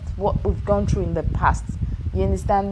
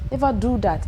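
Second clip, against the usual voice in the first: normal talking voice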